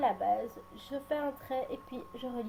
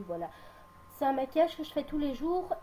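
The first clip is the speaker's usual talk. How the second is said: ordinary talk, nothing marked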